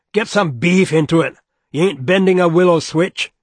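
loud, high barking orders; roaring foreman. A narrator's reading. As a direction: yell at the crew to push harder. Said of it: "he roared suddenly".

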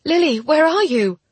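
high, loud panicked call; frantic, high-pitched shout; mental scream. A narrator's reading. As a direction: scream it urgently to a distant friend. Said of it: "I added a mental scream".

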